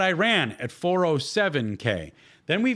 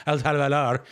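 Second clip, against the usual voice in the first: mock Spanish accent; 2 semitones lower than usual. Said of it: "say it in the accent".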